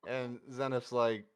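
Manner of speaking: usual speaking voice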